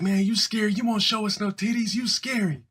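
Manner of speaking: high mock kid taunt. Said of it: "We'd be like".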